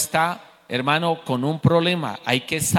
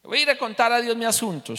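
higher-pitched quote of Ana; Ana's resolve; 7 semitones higher, 3 dB quieter than usual. Acted. Ana deciding to tell God her trouble, resolved and high. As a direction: voice her decision in a raised pitch. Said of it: "Entonces ella dijo".

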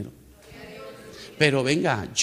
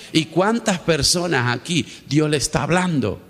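fervent preaching; 3 semitones higher, 4 dB louder than usual. Acted. Pastor tells the church about the repaid loan, fervent preaching. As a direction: preach it, higher and driving.

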